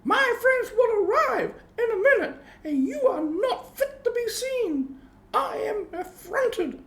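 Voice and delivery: high indignant mother cat. A narrator's reading. Mother cat scolding her naked kittens, high and indignant. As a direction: scold them, high and offended. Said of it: "said Missus Tabitha Twitchit".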